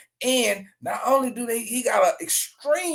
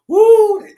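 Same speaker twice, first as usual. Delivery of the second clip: high, loud shocked woo; shocked whoop; 13 semitones higher, 11 dB louder than usual. Real, not acted. Host reacting to the news with a loud whoop. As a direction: let out a big stunned woo.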